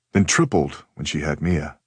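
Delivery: plain reading voice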